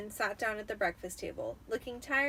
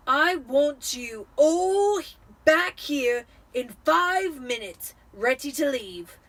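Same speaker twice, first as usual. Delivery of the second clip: loud, slow, higher commands; angry orders; 5 semitones higher, 10 dB louder, slower than usual. A narrator's reading. Vernon ordering the family out, loud and slow. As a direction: bark it like a frantic tyrant.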